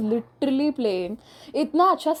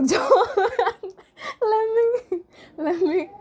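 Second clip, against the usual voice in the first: laughing; 11 semitones higher than usual. Real, not acted. Laughing fit breaking voice.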